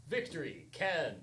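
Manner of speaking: ordinary talk, nothing marked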